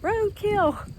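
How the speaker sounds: playful high-pitched hawk voice; hungry hawk's thoughts. Pretend. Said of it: "he's probably thinking".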